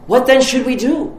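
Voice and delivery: a crowd begging for answers; eager crowd question. Retold or imitated. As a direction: people in the wilderness urging John for instructions. Crowd asks John eagerly.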